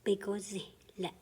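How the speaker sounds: "Bigozi le" said quickly as one word; quick, as the teacher says it; quick run-through. Shown as a demonstration. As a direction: rattle off "Bigozi le" fast. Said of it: "a long word that you kinda say fast".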